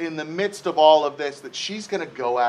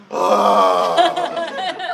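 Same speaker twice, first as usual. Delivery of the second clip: loud, high exasperated "ugh"; frustrated huff; 6 semitones higher, 3 dB louder than usual. Retold or imitated. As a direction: huff loudly to be overheard. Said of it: "getting louder and louder in the kitchen".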